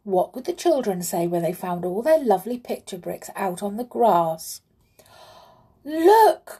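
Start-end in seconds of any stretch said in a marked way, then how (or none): 5.8-6.6 high, loud cry of alarm; startled exclamation, pitched way up; sudden cry. A narrator's reading. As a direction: call out suddenly to get everyone looking. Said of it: "cried the clown suddenly".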